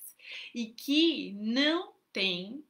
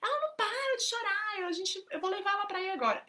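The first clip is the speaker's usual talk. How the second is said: high, hurried anxious voice; mother-in-law calling, worried the child won't stop crying; worried grandmother; 11 semitones higher, faster than usual. Retold or imitated. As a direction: rush it like a flustered grandma. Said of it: "falando assim".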